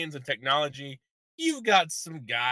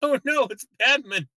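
high, loud, slow mock grief; Gordon lament; 10 semitones higher, 5 dB louder than usual. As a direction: wail like a stricken Gordon over a box. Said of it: "be like".

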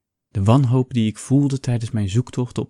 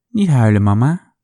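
put-on child comforting; 8 dB louder than usual. A narrator's reading. Little girl comforting her mother.

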